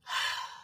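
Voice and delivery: re-enacted heavy sigh. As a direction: let out a breath to reset. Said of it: "I went like this".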